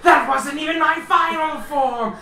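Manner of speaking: high, loud, hammed-up villain bellow; villain boast. GM voicing the alien boss bragging as he transforms. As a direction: roar it out like a cartoon villain powering up. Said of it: "you hear the voice say".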